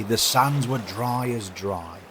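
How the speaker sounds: ordinary delivery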